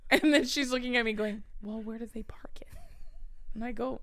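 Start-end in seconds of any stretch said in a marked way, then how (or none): 1.6-2.7 urgent question in a whisper; retold hushed, puzzled whisper; whispered aside. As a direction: whisper it privately to a friend. Retells friend's aside to her.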